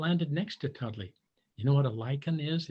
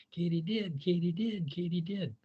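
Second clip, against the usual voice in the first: katydid call; 3 semitones higher than usual. Retold or imitated. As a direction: chirp it like the insect. Imitating insect song.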